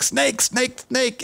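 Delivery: high-pitched imitated alarm in a Guatemalan accent; thick Guatemalan accent. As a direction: mimic a Guatemalan worker's urgent high warning. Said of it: "he's got this thick accent".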